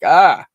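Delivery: loud mock cry of overwhelm; frustrated "ah". Imitating a friend overwhelmed by her life, frustrated yell. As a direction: burst out an imitated exasperated "ah".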